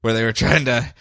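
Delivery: usual speaking voice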